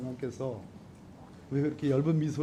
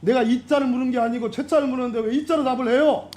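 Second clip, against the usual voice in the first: higher-pitched, exasperated protest; real raised voice; 8 semitones higher, 6 dB louder than usual. Real, not acted. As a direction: raise your voice at an evasive answer. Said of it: "여기 소리 지르는 데가 아니지 않습니까".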